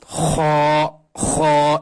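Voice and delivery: خا rasped from the throat, repeated slowly; drilled khaa. Shown as a demonstration. Teacher drilling students on خا, rasped from the throat. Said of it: "اس مشق کرنی پڑے گی".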